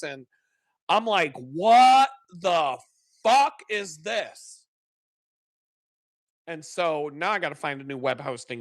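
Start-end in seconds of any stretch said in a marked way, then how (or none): 1.4-4.6 slow, drawn-out disbelieving "what the fuck"; stunned disbelief. Retold or imitated. A web developer seeing the client's homepage, slow stunned disbelief. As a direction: stretch it out like you can't believe your eyes.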